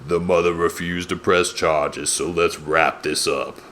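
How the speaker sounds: impersonating a cool-voiced sheriff, settling low. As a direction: play the effortlessly cool lawman. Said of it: "that guy's got the coolest voice".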